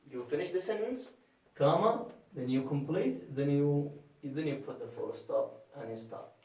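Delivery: usual speaking voice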